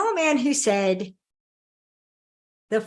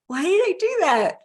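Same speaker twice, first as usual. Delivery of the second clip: bewildered outburst; 7 semitones higher than usual. Retold or imitated. Crying wife asks herself, pitched up in disbelief. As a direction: throw the question up in disbelief.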